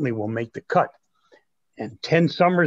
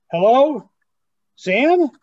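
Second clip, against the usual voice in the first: high, loud call into the call; 9 semitones higher, 6 dB louder, slower than usual. Real, not acted. Grandson calls out to an unknown caller, loud. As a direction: call out to see who joined.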